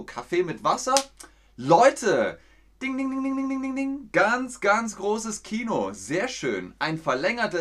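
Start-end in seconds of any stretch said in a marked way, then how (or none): 2.8-4.1 sung high bell-like ding ding ding; correct-answer bell. Pretend. Streamer rewards the right answer with a sung bell. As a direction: ring it out like a quiz show bell.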